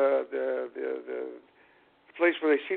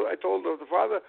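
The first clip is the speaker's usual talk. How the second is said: ordinary talk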